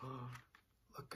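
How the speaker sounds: slow hushed whisper. Real, not acted. Teacher whispering to children about the knock, slow and hushed. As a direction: whisper it slowly, full of suspense.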